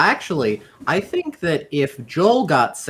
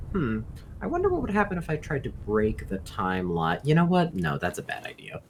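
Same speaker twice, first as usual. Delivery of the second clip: low, hushed musing to himself; under his breath; 5 semitones lower, 10 dB quieter than usual. Acted. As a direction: mutter it softly, trailing down to barely voiced. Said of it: "Says under his breath".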